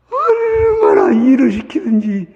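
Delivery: slow, high, loud cry of how hard they worked; a slow high shout of dismay; shouted exclamation. Retold or imitated. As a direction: belt it out in outrage. Preacher retells old man's hell.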